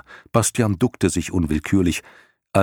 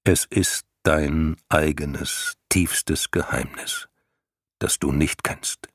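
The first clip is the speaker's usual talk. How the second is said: slow solemn lion; 2 semitones lower, slower than usual. Acted. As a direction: weigh each word like an old sage. Lion reveals a secret, slowly. (langsame, feierliche Löwenstimme)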